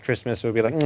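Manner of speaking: normal talking voice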